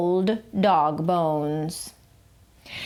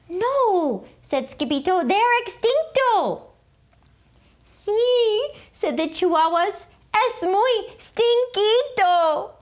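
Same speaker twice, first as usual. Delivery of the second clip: much higher little dog voices; high chihuahua voices; 11 semitones higher than usual. A narrator's reading. Chihuahuas joking about the smell, high. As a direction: squeak it like tiny dogs.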